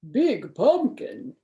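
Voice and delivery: a hammed-up storybook vampire. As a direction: stretch it out like a vampire. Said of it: "said the vampire".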